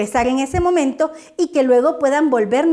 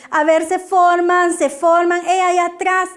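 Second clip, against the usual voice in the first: nagging scolding; 6 semitones higher than usual. Shown as a demonstration. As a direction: nag the children into line. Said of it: "estoy todo el tiempo como regañando".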